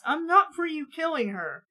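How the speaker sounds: ordinary delivery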